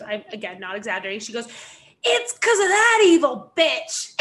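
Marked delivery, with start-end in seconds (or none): 2.0-4.1 loud, high, drawn-out yell; imitated scream. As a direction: imitate an irate passenger screaming an accusation. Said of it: "when I say yells slash screams".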